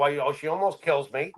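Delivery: ordinary talk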